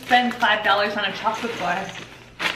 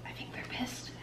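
hushed unvoiced whisper; 19 dB quieter than usual. Real, not acted. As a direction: whisper so no one hears. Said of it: "whisper about the neighbours".

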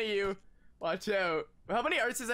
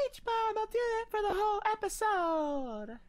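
put-on valley girl voice; 10 semitones higher than usual. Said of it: "Talk like a valley girl".

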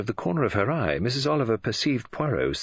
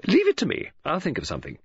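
quoting Mrs Oliver; 9 semitones higher, 3 dB louder, faster than usual. Retold or imitated. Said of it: "perhaps you could say".

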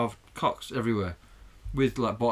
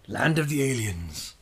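slow, drawn-out spooky announcing; mock-eerie intro; much slower than usual. Host returning to the land of the aliens, mock-eerie intro. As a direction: stretch each word like a hammy sci-fi title.

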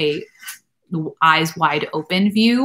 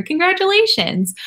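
much higher, bright congratulations; excited congrats; 12 semitones higher, 3 dB louder than usual. Real, not acted. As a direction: cheer the viewer on. Viewer accepted to nursing school.

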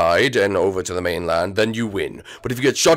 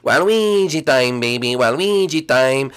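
Waluigi voice; 4 semitones higher than usual. Acted. Waluigi catchphrase.

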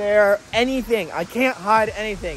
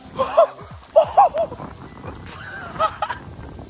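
high sharp gasp; shocked cold gasp. Real, not acted. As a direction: gasp at icy water. Under waterfall.

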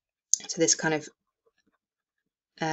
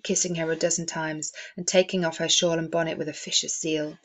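ordinary talk

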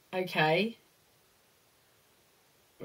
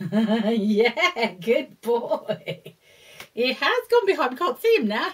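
loud, high, delighted praise; excited praise; 3 semitones higher, 8 dB louder than usual. Real, not acted.

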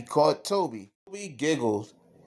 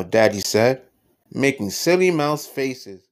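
usual speaking voice

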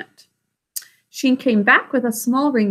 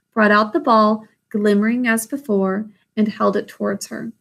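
plain reading voice

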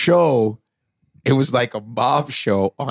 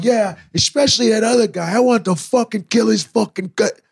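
mob guys; 9 semitones higher than usual. Retold or imitated. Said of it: "They're all going".

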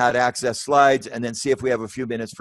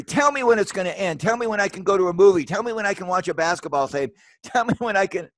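higher, building heartfelt plea; emotional pleading; 6 semitones higher, faster than usual. Real, not acted. Speaker to audience, longing for normal life, higher emotional pleading. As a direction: beg for an end, voice lifted high. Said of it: "oh, I'm gonna get emotional".

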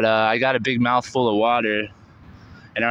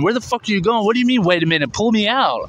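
high, frantic demand, not full volume; retold scream; 9 semitones higher than usual. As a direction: reenact screaming at a would-be rescuer. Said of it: "I remember just screaming at him".

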